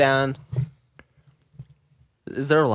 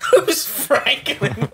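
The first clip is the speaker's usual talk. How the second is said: laughing; 10 semitones higher, much slower than usual. Acted. Mocking repeat of the question.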